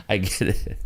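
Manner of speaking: ordinary delivery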